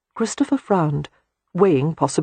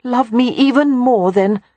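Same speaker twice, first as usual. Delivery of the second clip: high and louder, pleading; begging; 8 semitones higher, 6 dB louder than usual. A narrator's reading. Beatrix pleading with Christopher to love her more, begging loudly. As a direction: beg him with everything you have. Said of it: "she begged".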